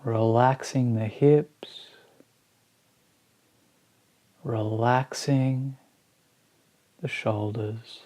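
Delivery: slow, spacious meditation guidance with long pauses; guided meditation. Acted. Teacher guiding listeners through a body scan, slow meditation voice. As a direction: ease each phrase out slowly, letting it settle.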